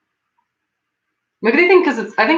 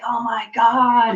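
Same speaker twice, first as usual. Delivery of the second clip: slow, drawn-out fed-up Oh my God; exasperated groan; 5 semitones higher, much slower than usual. Retold or imitated. Friend groaning at strangers who ask about his hair, exasperated. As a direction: stretch it out like you're sick of hearing it.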